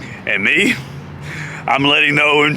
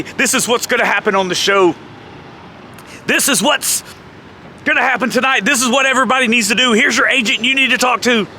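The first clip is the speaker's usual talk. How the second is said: imitating a meeting briefing, pitched up; lightly voiced boss; 6 semitones higher than usual. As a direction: rattle off orders like a show boss, lightly acted. Jeff running pre-show meeting.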